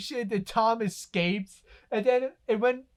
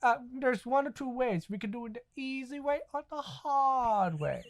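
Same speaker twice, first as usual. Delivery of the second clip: lightly voiced cartoon convict, stretched last words; Booty Warrior; 4 semitones higher, 6 dB quieter than usual. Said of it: "he was the booty warrior".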